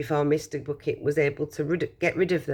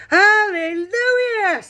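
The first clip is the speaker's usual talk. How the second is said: high, loud, slow drawn-out cry of joy; joyful yell; 7 dB louder, much slower than usual. Acted. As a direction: stretch it out like a jubilant old man. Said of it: "yelled Grandpa Joe".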